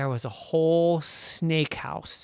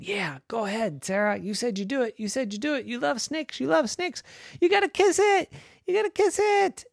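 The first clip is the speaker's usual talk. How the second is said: climbing higher, taunting singsong; teasing sister; 12 semitones higher than usual. Acted. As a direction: egg her on, rising each time. Mary egging Sarah on.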